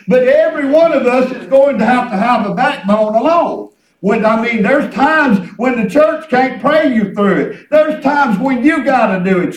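loud exhortation with stretched words; fervent preaching. Real, not acted. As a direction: drive each phrase home to the congregation. Preaches with full voice.

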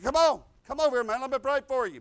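loud, fast call to come closer. Retold or imitated. Preacher calling the possessed man to the bars, loud urging. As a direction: beckon him hard across the bars.

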